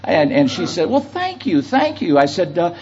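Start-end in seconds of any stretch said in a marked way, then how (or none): 0.9-2.2 put-on higher, light girlish voice for her quick yeahs; imitating the young girl. Preacher quoting the girl in the elevator, high girlish voice. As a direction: play the college girl chirping back.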